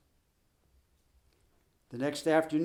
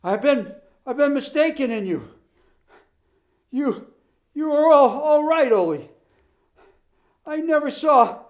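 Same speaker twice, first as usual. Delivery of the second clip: much higher, louder, halting breathless flattery; panting plea; 7 semitones higher, 8 dB louder than usual. Acted. Exhausted coach flattering Ole to stop, panting. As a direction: beg between breaths. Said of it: "he panted".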